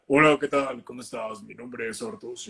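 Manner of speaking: imitating his old forced, placed voice; a mild mock of an artificial self-introduction; lightly voiced fake announcer hello; forced put-on voice. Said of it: "Impostar un poquito".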